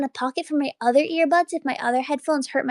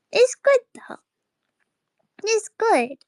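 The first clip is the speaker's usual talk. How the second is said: high squeak; 7 semitones higher, 3 dB louder than usual. Real, not acted. High squeak of self-reassurance.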